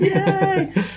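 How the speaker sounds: high, delighted squeal with a laugh; excited yay. Real, not acted. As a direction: squeal with glee. Excited about spending money.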